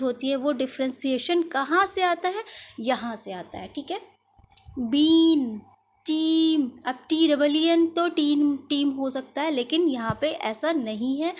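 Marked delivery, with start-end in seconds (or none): 4.8-6.7 louder careful बीन, टीम with long ee; model words. Shown as a demonstration. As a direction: project बीन and टीम to the class. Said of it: "pronunciation को ध्यान से सुनिएगा".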